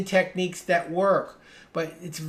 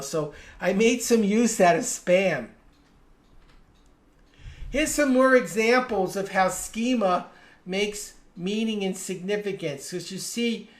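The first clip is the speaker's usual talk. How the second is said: ordinary talk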